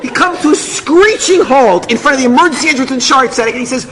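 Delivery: ordinary delivery, nothing marked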